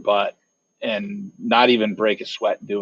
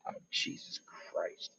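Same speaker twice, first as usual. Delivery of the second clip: whispered, breathless groan; whispered exasperation; 23 dB quieter than usual. Acted. Hound groaning at the news, whispered. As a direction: mutter it under your breath.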